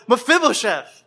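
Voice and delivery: high, joyful call of the name; king David calling out, overjoyed to find him; joyful cry. Acted. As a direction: cry out Mephibosheth! in glad surprise. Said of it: "he just cries out".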